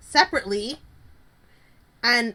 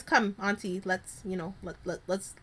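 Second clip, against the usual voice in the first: hushed coaxing; 5 semitones lower, 8 dB quieter than usual. Retold or imitated. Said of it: "Curtis finally stands up and says".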